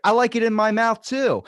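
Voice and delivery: loud, high mock Tyson, proud; Mike Tyson voice. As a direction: pitch up and boast like a cartoon boxer. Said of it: "he was like".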